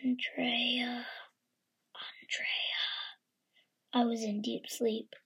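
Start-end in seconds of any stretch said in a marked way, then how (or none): 0.0-2.8 eerie whispered ghost call; breathy spooky whisper of a name; haunting voice. Acted. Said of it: "I heard an eerie voice".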